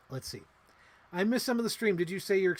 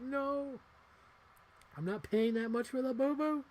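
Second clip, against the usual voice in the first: much higher, mock indignant cry; mock outrage; 10 semitones higher than usual. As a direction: protest in playful high-pitched outrage. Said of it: "I'm like".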